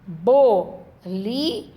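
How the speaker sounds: "Bolinho" stretched slowly, ending on a lift; slow teacher's "Bolinho", syllables apart. Shown as a demonstration. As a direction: sound out "Bolinho" slowly so children hear the syllables. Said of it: "a professora fez uma seta na sílaba do meio".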